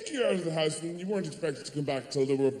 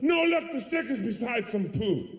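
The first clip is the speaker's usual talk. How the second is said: put-on insistent child; 8 semitones higher than usual.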